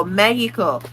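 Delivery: "Mexico" as "MEH-hee-ko", Spanish-style; Spanish pronunciation. Retold or imitated. Mocking non-speakers' pronunciation, Spanish accent. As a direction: put on a Spanish "Mexico", X as breathy H. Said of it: "pronouncing it the way that your accent".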